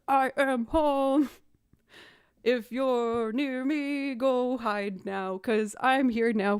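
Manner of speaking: exaggerated sing-out warning to an empty room; big announcing scene. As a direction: announce yourself to hidden spiders, every word drawn out. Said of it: "a big scene to no one other than the spiders".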